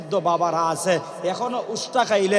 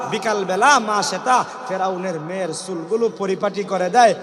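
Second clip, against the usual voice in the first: ordinary delivery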